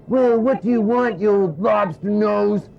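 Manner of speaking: high, loud and slow scolding puppet wife; Judy puppet voice. Acted. Judy snapping at Punch, high and slow. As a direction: sneer it at him like a cross Judy. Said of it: "She says".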